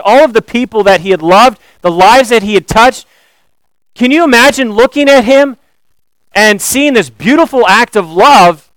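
normal talking voice

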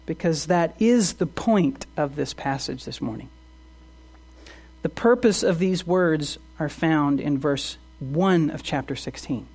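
normal talking voice

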